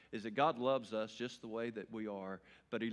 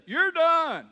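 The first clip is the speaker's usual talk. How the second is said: higher, louder mama saying "You're done" with stress on You're; shrill-free but sharply raised mother's final word; Mama's voice; 16 dB louder than usual. Retold or imitated. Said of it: "There's a time when Mama says".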